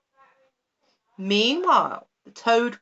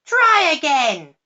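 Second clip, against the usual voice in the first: louder, higher, slow command; impatient command; 8 semitones higher, 8 dB louder, slower than usual. A narrator's reading. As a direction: bark it out slowly as the witch. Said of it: "cried the witch".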